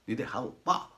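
usual speaking voice

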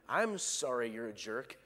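mocking backhanded sorry; lightly voiced smug jerk; snide fake apology. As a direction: sneer the apology like you mean the insult. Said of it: "the evil people I used to pastor".

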